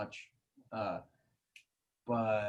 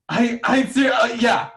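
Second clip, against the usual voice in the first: excited; 5 semitones higher, 5 dB louder than usual. Real, not acted. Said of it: "I'm not kidding".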